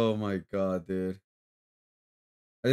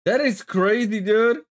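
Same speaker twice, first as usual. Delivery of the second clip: excited disbelief; 8 semitones higher, 4 dB louder than usual. Real, not acted. Reacting to a wild clip.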